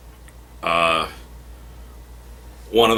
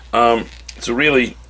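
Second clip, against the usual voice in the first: usual speaking voice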